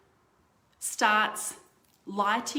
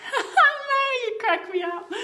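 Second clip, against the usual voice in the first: laughing hard through the name, pitched way up; 8 dB louder than usual. Real, not acted. Host cracking up at Mary's comment, laughing. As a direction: crack up at a viewer's joke.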